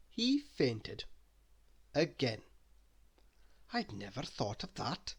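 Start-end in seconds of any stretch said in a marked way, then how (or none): 3.7-5.1 soft mutter to himself. Acted. Gunner muttering to himself about the danger. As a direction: mutter it under your breath. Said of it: "muttered the gunner to himself".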